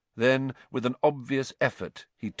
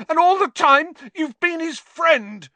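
furious shout; 9 dB louder than usual. A narrator's reading. Harry shouts at Lupin in fury, high and wavering. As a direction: shout with fury barely held. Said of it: "his voice wavering out of control".

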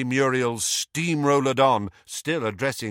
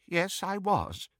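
quiet, shaken reply; trembling lips; 9 semitones higher, 5 dB quieter than usual. A narrator's reading. Old friend recalling the funeral, quiet shaken reply. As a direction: hold back tears. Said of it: "said Doge through trembling lips".

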